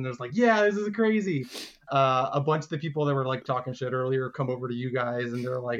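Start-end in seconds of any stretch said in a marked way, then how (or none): 0.4-1.5 higher-pitched, thrilled cheer; stoked crowd member. Acted. Stoked spectator after the win, excited higher voice. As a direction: voice an amazed fan. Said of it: "super stoked that you guys actually won".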